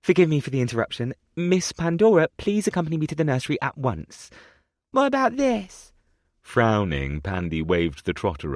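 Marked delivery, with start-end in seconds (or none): 0.0-4.3 a lightly voiced flustered nursemaid, fast; hurried nursemaid. A narrator's reading. Flustered nursemaid apologizes to the duke, fast and hurried. 4.9-5.9 put-on high childish question; a little girl holding up her prize; small girl voice. A narrator's reading. Little girl asks the nursemaid, high childish question.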